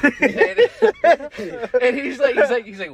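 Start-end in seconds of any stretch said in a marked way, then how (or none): 0.2-1.8 high burst of laughter; loud laughter. Real, not acted. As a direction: crack up loudly. Laughing hard at own story.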